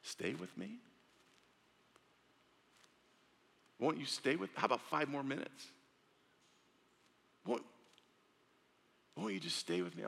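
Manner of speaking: a hint of a longing God; tender plea as God. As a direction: gently beg them to stay. Preacher voicing God pleading.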